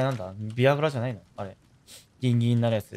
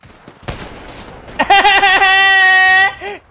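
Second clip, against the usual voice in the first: loud laughing fit; 9 dB louder than usual. Real, not acted. Bursting out laughing at own joke.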